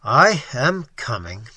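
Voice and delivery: every word of "I am coming" weighted slowly and evenly; slow, startling emphasis. Acted. Brandon answers the servant, slow heavy emphasis on each word. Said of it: "with a slow and startling emphasis on each word".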